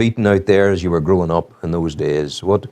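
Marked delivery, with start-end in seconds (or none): none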